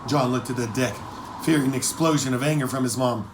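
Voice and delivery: ordinary delivery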